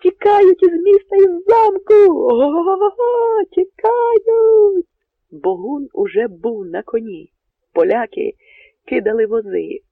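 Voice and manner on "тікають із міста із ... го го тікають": very high, loud, slow whoops of triumph; sentry whooping as the Poles run; triumphant whooping. Acted. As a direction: crow over the fleeing enemy. Sentry sees the enemy fleeing.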